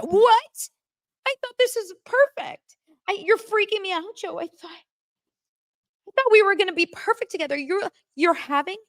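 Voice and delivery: high-pitched mock freak-out; Madison. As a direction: imitate a panicking fiancée, voice way up. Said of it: "without her freaking out".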